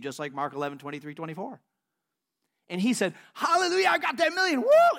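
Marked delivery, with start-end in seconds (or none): 3.3-5.0 high, loud hallelujah shout rising to a whoop; jubilant screaming. Retold or imitated. Man celebrates the million he prayed for, high jubilant shouting. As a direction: whoop it out like sudden riches. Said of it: "jumping and dancing and screaming".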